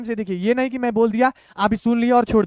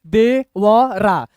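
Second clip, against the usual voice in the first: slow, each syllable held apart; much slower than usual. Shown as a demonstration. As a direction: read it out one letter at a time. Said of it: "अभी हम तीन ही अक्षर पढ़ेंगे".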